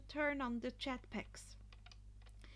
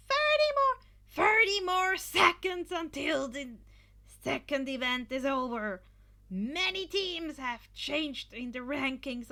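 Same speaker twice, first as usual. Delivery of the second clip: very high, loud screaming commentator; hyped announcer; 5 semitones higher, 10 dB louder than usual. A narrator's reading. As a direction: Present Mike counts down to the crowd, screaming loud. Present Mike announcing.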